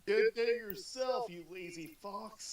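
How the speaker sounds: loud, slow, drawn-out proclamation; mock voice of God. Listener improvising God's answer to the prayer, mock booming.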